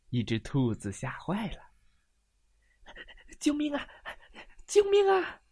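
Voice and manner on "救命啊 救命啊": high, loud panicked cries; frantic rabbit shouting help; rabbit crying for help. Acted. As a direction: scream for help like a terrified bunny. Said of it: "兔子喊道".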